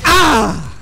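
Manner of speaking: loud, high release shout; loud triumphant scream as the kick lands; triumphant yell. Real, not acted. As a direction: let the held-in shout burst out. Releasing a long-held kick.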